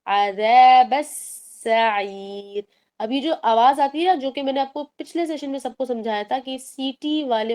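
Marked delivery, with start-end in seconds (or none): 0.0-2.6 عذاب السعیر very slow, with thin light letters; teacher modelling thin letters in عذاب السعیر; slow thin-letter recitation. Shown as a demonstration. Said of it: "باریک letters کے طریقے پر join کریں گی".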